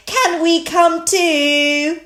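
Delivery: high, loud, slow little piglet voice; Peppa. A narrator's reading. Peppa asks to come along, high and drawn out. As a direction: plead in a drawn-out child's pitch. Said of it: "Peppa said".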